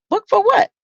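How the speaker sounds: high, squeaky disbelief; retelling her shocked reply to her coach, high and incredulous. Retold or imitated. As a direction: act stunned at the idea of a book.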